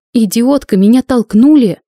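furious shout. Acted. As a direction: lose composure and yell back. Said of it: "закричала я".

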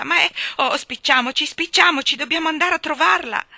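loud, high, frantic plea to hurry; desperate urging. A narrator's reading. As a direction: urge them on desperately. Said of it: "gridò Jane con voce disperata".

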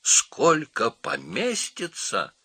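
high, slow, drawn-out question; incredulous monkey voice; astonished monkey. A narrator's reading. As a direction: stretch it out high in disbelief.

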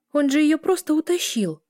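high, indignant protest. A narrator's reading. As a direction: complain in outrage, pitch jumping up. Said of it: "возмутилась Эля".

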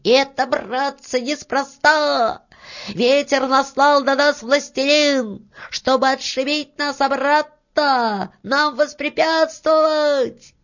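high, loud cry to his mates; little man yelling in alarm; alarmed shout. A narrator's reading. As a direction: shout out a warning at a very high pitch. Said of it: "закричал".

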